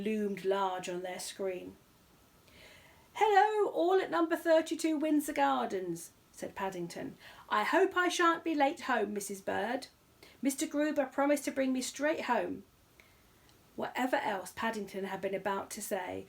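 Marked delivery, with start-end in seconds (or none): 3.1-6.1 high, bright bear voice; young bear greeting his family on TV; Paddington voice. Acted. Bear calls hello to the Browns on TV, high and eager. 7.5-12.6 high, bright bear voice; young bear greeting his family on TV; Paddington voice. Acted. Bear calls hello to the Browns on TV, high and eager.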